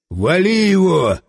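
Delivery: drawn-out, slow shouted order; narrated commander's shout, slow and firm; mild yell. A narrator's reading. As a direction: yell the order with some restraint. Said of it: "заорал Власов".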